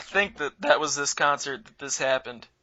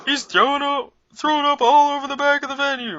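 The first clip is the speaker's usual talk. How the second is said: much higher mock yell of an angry bouncer; 12 semitones higher than usual. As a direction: bark him out of the venue, pitched way up. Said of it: "starts yelling at Bob".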